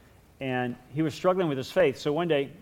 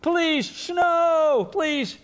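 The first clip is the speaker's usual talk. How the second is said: put-on very high, loud begging kid; pleading kid; 7 dB louder, much slower than usual. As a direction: beg the sky desperately. Calvin begging for snow.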